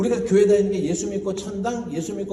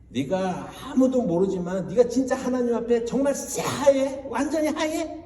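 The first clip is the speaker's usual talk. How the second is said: high-pitched mock grilling, voice climbing higher; interrogating accusation; 7 semitones higher than usual. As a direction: press him hard like an accusing friend. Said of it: "막 이렇게 따지는 거예요". (높은 목소리로 몰아붙이며 따지듯)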